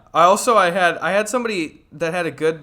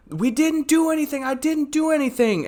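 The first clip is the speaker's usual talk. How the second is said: frantic pleading; 12 semitones higher than usual. Retold or imitated. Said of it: "they were screaming".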